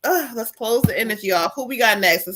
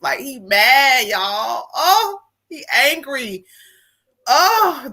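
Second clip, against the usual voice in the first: slow, breaking into tears; words pulled out through a rising cry; choked up; 3 semitones higher, much slower than usual. Real, not acted. Medium feeling the spirit's anger, voice breaking into tears.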